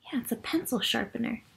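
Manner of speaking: put-on quick, hushed reveal to the children; whispered answer. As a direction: whisper it fast like a secret. Narrator answers own question.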